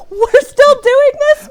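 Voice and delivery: slow, high, loud disbelief; exasperated disbelief. Retold or imitated. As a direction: drag it out, exasperated. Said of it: "It's like".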